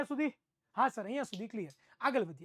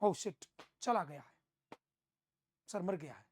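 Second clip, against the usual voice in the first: mock detective; 7 semitones lower, 7 dB quieter than usual. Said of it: "C I D માં".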